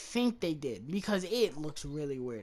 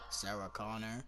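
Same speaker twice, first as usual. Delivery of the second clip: flat Terminator voice; 9 semitones lower, 11 dB quieter than usual. Retold or imitated. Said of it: "He's just like".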